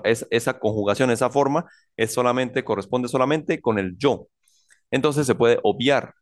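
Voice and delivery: ordinary delivery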